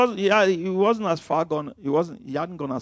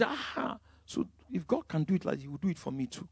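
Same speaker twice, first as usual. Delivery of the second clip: hushed inner realisation; 11 dB quieter than usual. Retold or imitated. Reader's inner thought, hushed.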